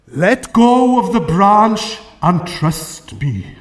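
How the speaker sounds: slow, booming voice from heaven; God. Acted. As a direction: intone it slowly, with solemn authority. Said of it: "I am God".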